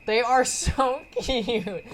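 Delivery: high, loud, drawn-out adoration; laughing squeal over cuteness; slow, emphatic fawning; real gushing. Real, not acted. Reader gushes over the characters.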